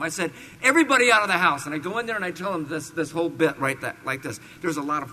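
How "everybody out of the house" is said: loud, fast order; commanding shout. Retold or imitated. Ordering the residents out of the house, loud command. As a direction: clear the house now. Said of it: "ordering everyone out".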